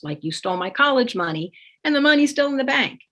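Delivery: usual speaking voice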